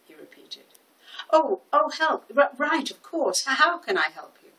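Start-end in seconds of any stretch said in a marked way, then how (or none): 1.3-4.5 high, loud startled stammer settling down; flustered. A narrator's reading. Smitten florist remembers to help the customer, flustered and eager. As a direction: snap to, stumbling over the words.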